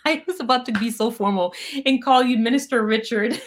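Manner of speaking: talking through laughter; laughing. Real, not acted. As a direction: laugh your way through the admission. Laughs at own near slip.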